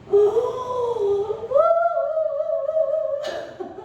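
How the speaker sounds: high sung wailing cry; a mournful lament held high and long; demonstrated wail. Shown as a demonstration. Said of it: "통곡하며 소리 내어서 울었다".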